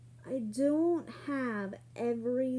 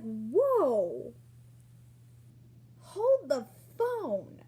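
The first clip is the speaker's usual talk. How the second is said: stunned; 3 semitones higher, 6 dB louder, much slower than usual. Retold or imitated. Stunned reaction to an insult.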